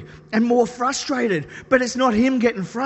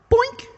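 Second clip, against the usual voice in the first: high squeaky boink sound; comic boink. Retold or imitated. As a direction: pop it out like a cartoon noise.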